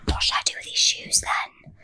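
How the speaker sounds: hushed, whispered quote; imitated whispered question; mock whisper. Retelling his coy question about shoes.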